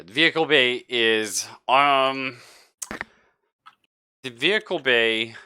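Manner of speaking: ordinary talk, nothing marked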